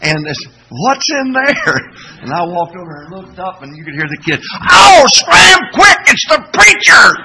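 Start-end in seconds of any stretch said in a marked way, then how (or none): 0.7-1.8 put-on high, slow kid's voice asking; small child calling down from above; child's voice. Kids in the ceiling, high slow kid voice. 4.7-7.2 put-on high, loud panicked kid yell; kid's shout. Kid warns the others the preacher is coming, high shout.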